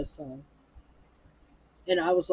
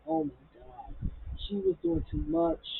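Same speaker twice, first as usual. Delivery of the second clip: exasperated; 12 semitones higher than usual. Real, not acted. Exasperated reaction to Toya.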